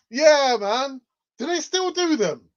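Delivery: loud, high, thrilled; excited. Real, not acted. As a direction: shout it out in delight. Thrilled rediscovering childhood drink.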